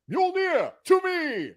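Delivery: high-pitched, drawn-out mock Thor accent. Shown as a demonstration. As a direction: summon the hammer in a hammed-up Thor accent. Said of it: "that's my Thor accent".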